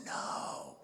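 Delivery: eerie, breath-only whisper; hushed voice. Acted. Mysterious voice answering the gum being spat out, whispered. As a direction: whisper it like a voice from nowhere.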